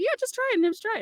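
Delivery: very high, breezy acted coaxing; breezy urging. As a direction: toss it off high, urging someone on. Voices agents brushing off her doubt.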